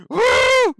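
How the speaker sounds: put-on high, loud, laughing drunk cheer; drunken cheering. Drunk ringside fan cheering the leap, loud and laughing. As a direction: whoop it up like a tipsy fan.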